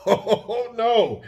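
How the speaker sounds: laughing. Real, not acted. Laughing at own cold complaint.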